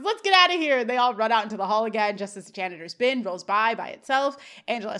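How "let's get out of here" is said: high, loud call to flee; rally everyone to run, voice pitched up; imitating an alarmed shout; mock urgent yell. Retold urgent cry to flee.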